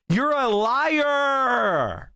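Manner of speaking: very slow, drawn-out shouted accusation; emphatic shout. Real, not acted. Streamer calling out the cable pundit, slow shouted accusation. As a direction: hammer out each word.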